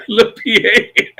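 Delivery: words squeezed out through a laugh; can't keep a straight face about the cheap gear. Real, not acted. Telling the host about buying a little PA, laughing. Laughing at his own haste.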